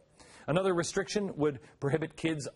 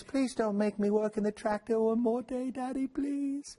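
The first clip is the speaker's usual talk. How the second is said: high, pleading mock child voice; hammed-up waif begging, slowing and softening at the end; little orphan; 8 semitones higher than usual. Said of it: "little Disney dirty orphans".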